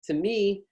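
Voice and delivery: "me" lifted high, as a model to copy; rising intonation on ME. Shown as a demonstration. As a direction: lift the pitch on "me". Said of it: "that's why me is underlined".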